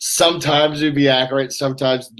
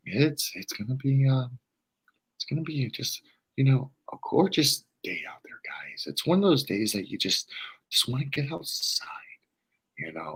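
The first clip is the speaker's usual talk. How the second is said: imitating the soft-spoken weather guy fading into a whisper; super quiet; 8 dB quieter than usual. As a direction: lull the viewers with a near-whispered forecast. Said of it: "That's how he talks".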